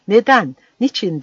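high, loud shout in his face. Acted. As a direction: bellow it right in his face. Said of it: "diye haykırıyordu". (yüzüne dayanmış, yüksek sesle haykırış)

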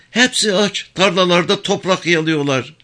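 high, pleading village woman voice; worried old mother. Acted. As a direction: press her son with anxious advice.

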